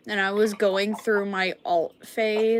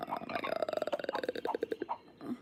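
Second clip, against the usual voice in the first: strained whisper; 10 dB quieter, much slower than usual. Real, not acted. Painful cough, throat hurts.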